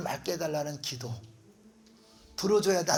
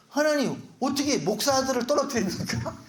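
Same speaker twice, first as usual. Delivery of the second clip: higher-pitched mock indignant protest; retold anger; 7 semitones higher than usual. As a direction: complain to God in comic outrage. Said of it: "내가 막 화가 나는 거야". (하나님께 화내며 따지는 흉내)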